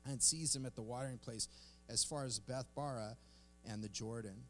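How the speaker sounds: soft, low, measured read-aloud; scripture reading. Acted. Pastor reading scripture to the congregation, quiet measured reading. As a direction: read the passage quietly and evenly.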